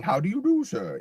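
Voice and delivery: a mock-royal British greeting; posh British accent. Teatime greeting over crumpets in a posh British accent. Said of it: "say it with that accent".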